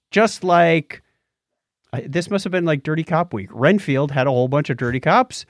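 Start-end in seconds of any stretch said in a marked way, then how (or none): none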